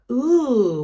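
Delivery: Mummy Rabbit delighted by carrots; delighted ooh. Acted. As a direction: admire the carrots.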